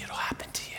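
hushed, breathy whisper. Retold or imitated. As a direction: lean in and whisper it like a secret. Algerian promising the kid quietly.

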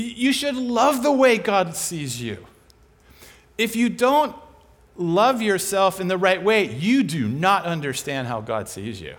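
ordinary delivery

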